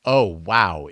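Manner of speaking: ordinary talk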